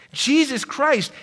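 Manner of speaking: ordinary delivery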